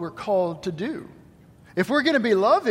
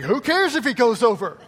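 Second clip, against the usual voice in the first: lightly imitated daughter, higher pitch; mock a kid who couldn't care less; playful take on a dismissive daughter; 4 semitones higher, 4 dB louder than usual. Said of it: "she's just like".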